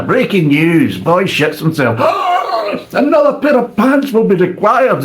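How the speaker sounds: laughing mock newsreader; mock news anchor. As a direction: announce a silly story like breaking news. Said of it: "this is all you get, is disaster after disaster".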